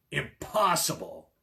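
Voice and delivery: slow roaring ogre; roared. A narrator's reading. Ogre roaring at the cat in disbelief. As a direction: boom it out slowly like an angry ogre. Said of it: "roared the ogre".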